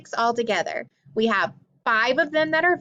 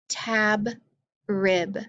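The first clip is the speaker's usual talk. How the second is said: usual speaking voice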